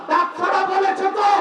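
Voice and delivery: high, impassioned preaching cry; preacher voicing a scholar's answer, high and impassioned; fiery preaching. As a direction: preach it at full force to the crowd. Preaching at full force.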